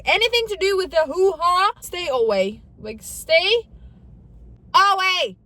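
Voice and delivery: loud, high burst of exaggerated dread; emphatic warning. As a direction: warn them off loudly, punching the final words. Exaggerated warning about her phobia.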